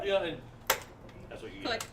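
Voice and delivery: ordinary delivery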